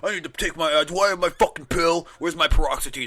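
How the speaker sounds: hammed-up hypocrite voice; mock hypocrite parent. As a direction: lampoon a pill-popping parent, loud and high. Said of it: "As they're fucking sucking down".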